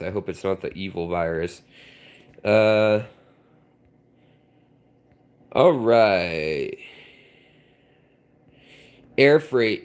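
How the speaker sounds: ordinary delivery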